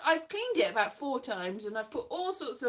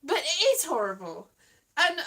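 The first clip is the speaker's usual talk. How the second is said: ordinary talk, nothing marked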